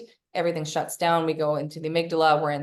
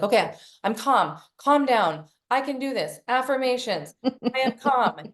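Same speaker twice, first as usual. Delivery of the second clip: forced calm, pitched up and drawn out; hammed-up self-soothing in a raised voice; mock affirmations; 6 semitones higher, 3 dB louder, slower than usual. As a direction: chant it slowly, as if convincing yourself.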